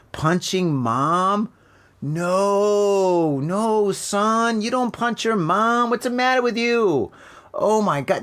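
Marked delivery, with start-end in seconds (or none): 2.0-7.1 higher, scolding a son who punched his mom; shocked parental scolding. Pretend. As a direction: tell the boy off like a shocked parent.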